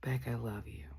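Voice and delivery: unvoiced soft whisper of love through a door; [name]'s whisper. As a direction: breathe it gently, barely audible, like a friend outside the door.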